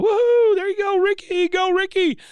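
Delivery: very high, loud cheering; squealing mock cheer from the stands; [name]. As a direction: whoop it up like an overexcited fan. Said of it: "you hear [name] go".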